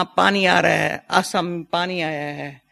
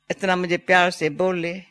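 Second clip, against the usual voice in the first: ordinary talk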